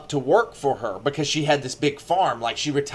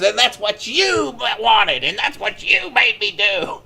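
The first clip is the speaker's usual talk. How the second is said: creepy confession again; 7 semitones higher than usual. Retold or imitated. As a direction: drag it out like a gloating killer. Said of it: "she goes".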